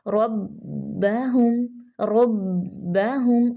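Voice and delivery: रब्बहुम very slow with heavy rolled रॉ and held doubled बा; slow tajweed. Shown as a demonstration. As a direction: stretch रब्बहुम, stop on the बा. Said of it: "आवाज़ रुक जाती है".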